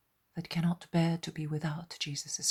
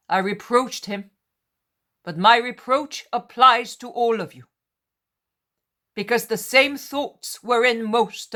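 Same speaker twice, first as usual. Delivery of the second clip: much louder, solemn reproving address; solemn Jesus; 5 semitones higher, 15 dB louder than usual. A narrator's reading. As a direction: address the apostles gravely. Jesus addressing all apostles.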